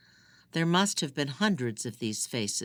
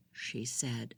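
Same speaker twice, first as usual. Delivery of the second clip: usual speaking voice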